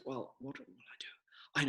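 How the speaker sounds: small scared whisper, slowing down; frightened whisper. Acted. Baby balloon whispering in fear, frightened whisper. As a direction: breathe it like a scared little balloon.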